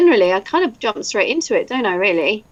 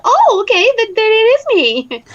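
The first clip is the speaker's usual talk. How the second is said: much higher, louder mock realisation; acted climb-down; 12 semitones higher, 5 dB louder than usual. Told she is credited for the role, comically high climb-down. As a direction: concede in a high, loud, comic squeal of surprise.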